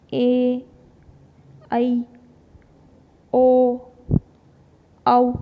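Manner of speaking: loud, drawn-out ए ऐ ओ औ; teacher sounding vowels one by one; vowel demo. Shown as a demonstration. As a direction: voice each vowel loud for the class. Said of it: "अब अ तो हमारा मध्य आ गया".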